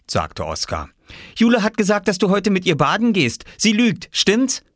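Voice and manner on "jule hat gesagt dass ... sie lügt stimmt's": high-pitched eager questioning; anxious little boy. Acted. Oskar asking Viktor about his sister's claim, urgent. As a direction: seek confirmation in a child's voice.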